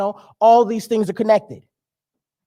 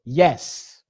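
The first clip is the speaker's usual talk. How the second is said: a lower, distant cosmic voice saying yes; the universe answering; 8 semitones lower, 3 dB quieter than usual. Pretend. Universe agreeing with the grumbling driver, lower and distant. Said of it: "the universe only says yes".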